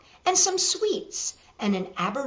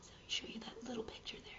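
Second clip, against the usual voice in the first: whisper; 23 dB quieter, faster than usual. Real, not acted. Aside while showing a picture.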